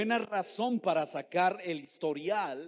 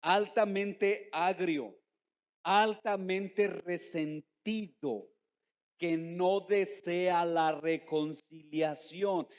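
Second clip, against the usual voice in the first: "altamente agrio" dicho muy despacio, cada sílaba marcada; énfasis lento; much slower than usual. Real, not acted. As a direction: arrastra "altamente resentido" con peso. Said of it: "¿Escuchó eso?". (palabras lentas y subrayadas para que se escuchen)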